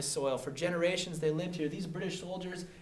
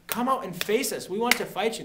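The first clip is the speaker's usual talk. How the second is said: raised pitch, exasperated challenge; mock exasperation; 5 semitones higher, 4 dB louder than usual. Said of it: "They got sick and tired".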